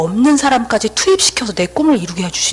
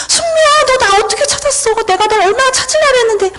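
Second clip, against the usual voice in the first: emotional reunion outburst; 12 semitones higher than usual. Retold or imitated. To the friend who tracked her down, emotional outburst. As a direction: pour it out to a friend lost for years.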